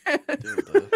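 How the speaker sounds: Dildo laughed out, mocking the Geordie way; laughing Geordie dildo. Mates mocking his Geordie word, laughing. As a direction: giggle through Dildo like a mate's accent. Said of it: "the way I say dildo".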